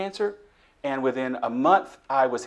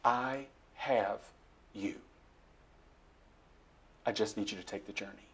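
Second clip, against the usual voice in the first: sweet voice of God; 5 semitones lower, 12 dB quieter than usual. Retold or imitated. God comforting him in his darkest hour, soft and tender. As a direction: speak gently as a loving God. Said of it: "the sweet voice said to me".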